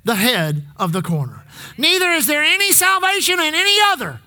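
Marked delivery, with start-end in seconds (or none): 1.8-4.2 preaching at full force. Acted. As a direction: build it up, pounding each repeat. Preacher builds on repeated phrase.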